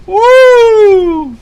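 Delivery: high loud whoop of alarm; startled whoop. Real, not acted. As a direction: let out a shocked woo. After lightning strike.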